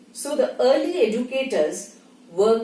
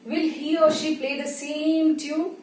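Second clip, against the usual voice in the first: high, sustained level pitch; raised monotone question; one-note tune; 6 semitones higher than usual. Shown as a demonstration. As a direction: hold it up on one note, stretching "same". Illustrates one unchanging musical tune.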